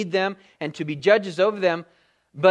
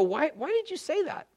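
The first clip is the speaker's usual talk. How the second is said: puzzled question; 10 semitones higher, 6 dB quieter than usual. Retold or imitated. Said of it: "I just go".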